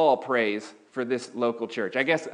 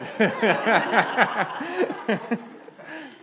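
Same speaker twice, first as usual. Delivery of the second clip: high amused laugh; 9 semitones higher than usual. Real, not acted. Laughs at own joke.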